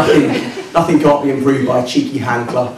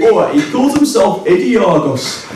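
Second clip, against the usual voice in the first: put-on slow, higher-pitched northern English accent; mock northern English friend, stretched out and pitched up; generic northern English accent; 6 semitones higher than usual. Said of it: "do a generic northern accent".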